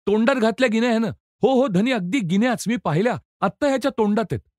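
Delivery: high, excited accusing cries; insistent shouting. A narrator's reading. Guards swearing the prisoner has coins in his mouth, crying out insistently. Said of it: "जमादार वॉर्डर प्रतिज्ञेवर ओरडू लागले".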